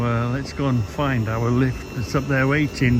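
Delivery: ordinary delivery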